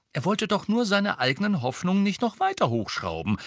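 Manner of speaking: high-pitched know-it-all tone; precocious. A narrator's reading. Hermine analysing Sirius for her friends, precocious. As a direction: explain it like a little know-it-all. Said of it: "sagte Hermine altklug".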